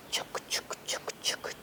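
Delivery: breathy whispered chuk chuk of a train; whispered train chugging. A narrator's reading. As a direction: mimic a distant train, whispered. Train sound effect in story.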